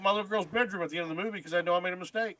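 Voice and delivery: hammed-up confused husband pleading; mock husband. Mocking the husband's lines.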